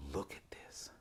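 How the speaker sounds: hushed, secretive whisper. Retold or imitated. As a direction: breathe it like a secret. Creepy man shows attic.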